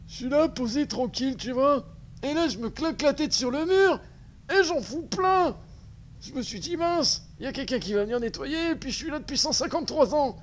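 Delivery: high-pitched cartoonish voice; squeaky little creature telling its woes; character voice. Voices a stuck game character.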